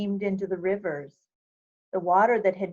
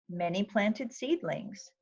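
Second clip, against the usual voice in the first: plain reading voice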